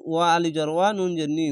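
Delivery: ordinary delivery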